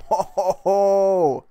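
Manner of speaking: loud hearty ho ho ho; belly-laugh it like Santa; jolly ho ho ho. Pretend. Celebrating a gutsy jump.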